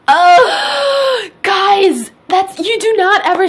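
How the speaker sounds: high, loud gasping outcry; outraged shout. Real, not acted. To viewers about the husband's comment: outraged shout. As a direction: explode in disbelief at him.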